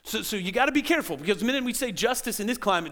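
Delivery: usual speaking voice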